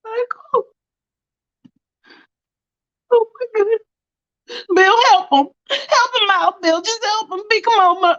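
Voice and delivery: high, tearful plea for help; distressed, whimpering urgency; acted panic. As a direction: beg frantically, pitch way up. Panicking, begging Bill to help.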